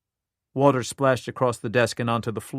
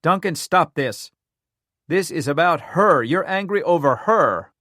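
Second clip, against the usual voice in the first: higher, louder pleading yell; 6 semitones higher, 5 dB louder than usual. A narrator's reading. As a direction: shout him down over the noise.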